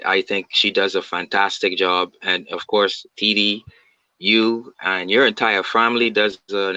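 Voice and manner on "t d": ordinary talk, nothing marked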